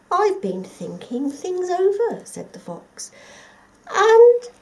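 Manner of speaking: butter him up; scheming fox. Acted.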